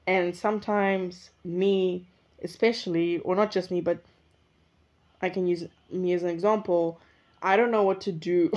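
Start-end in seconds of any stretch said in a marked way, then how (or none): none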